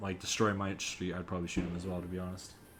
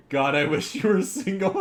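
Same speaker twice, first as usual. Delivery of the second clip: laughing while reading; 7 semitones higher, 8 dB louder than usual. Real, not acted.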